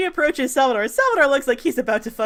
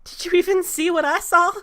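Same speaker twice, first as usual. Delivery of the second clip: high, louder incredulous question; distressed; 10 semitones higher, 4 dB louder than usual. Acted. Sal asked what he fears, distressed. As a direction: push back, upset and frightened.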